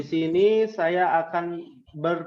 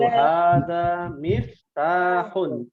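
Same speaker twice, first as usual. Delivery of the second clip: slow model answer; much slower than usual. Shown as a demonstration. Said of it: "hadza dua harakat ya".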